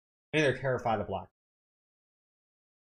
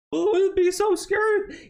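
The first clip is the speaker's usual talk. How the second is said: high, loud, slow mocking whine; mock fear; 6 dB louder than usual. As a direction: fake terror, pitched way up. Mocking scared opponents.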